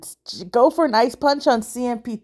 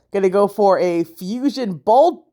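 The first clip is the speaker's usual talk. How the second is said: ordinary talk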